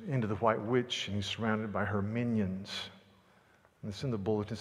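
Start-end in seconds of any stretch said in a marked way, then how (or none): none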